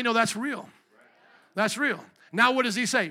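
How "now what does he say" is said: usual speaking voice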